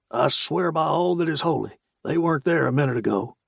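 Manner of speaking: gruff, insistent sergeant; challenging. A narrator's reading. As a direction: challenge anyone to doubt it. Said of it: "said in a challenging voice".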